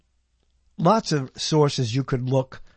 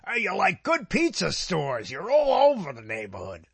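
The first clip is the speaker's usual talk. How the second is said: mock New Yorker; 4 semitones higher than usual. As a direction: parody the New York saying. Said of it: "They can't say".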